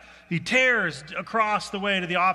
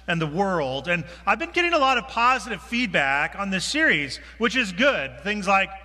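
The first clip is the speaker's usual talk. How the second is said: usual speaking voice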